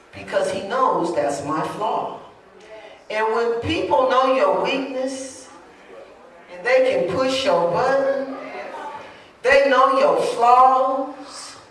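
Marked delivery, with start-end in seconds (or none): 3.1-11.6 raised pitch, drawn-out warning; deliberate preacher building in force; emphatic preaching. Acted. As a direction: stretch the phrase endings as you warn the congregation.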